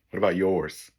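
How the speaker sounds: normal talking voice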